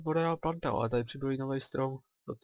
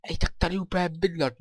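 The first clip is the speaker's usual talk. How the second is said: excited; 6 semitones higher, 6 dB louder than usual. Real, not acted. Finds a bay to live by.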